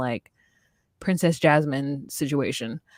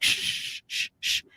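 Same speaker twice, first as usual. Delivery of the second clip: unvoiced hushed shush; imitated shushing; 6 dB quieter, slower than usual. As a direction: hiss everyone quiet. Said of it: "And she's like".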